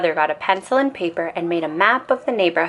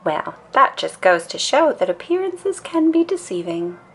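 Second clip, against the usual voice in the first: higher, jokey papa voice; lightly voiced papa; 7 semitones higher than usual. A narrator's reading. Father teasing the family, playful voice. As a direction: play the papa with a wink. Papa's line in story.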